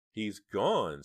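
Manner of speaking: shaky relieved voice; trembling voice. A narrator's reading. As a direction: let the voice tremble. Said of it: "her voice trembling".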